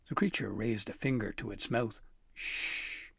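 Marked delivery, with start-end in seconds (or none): 2.3-3.1 unvoiced hush; shushing. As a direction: shush him softly. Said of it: "it gestured".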